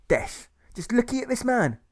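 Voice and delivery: high-pitched outraged character voice; squawking indignation from a comic bandit; indignant bandit. Acted. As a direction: exclaim it very high, affronted. Bandit scorns Alfred.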